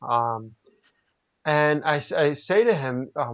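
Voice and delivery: ordinary talk, nothing marked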